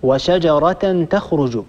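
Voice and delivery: وشجرة with the final ta said as t, linking into تخرج; slow demo: وشجرة تخرج, the ending voiced as "-ratun"; taa in connected speech. Shown as a demonstration. Teacher demonstrates reading وشجرة تخرج with a t. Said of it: "يعني نقول مثلًا".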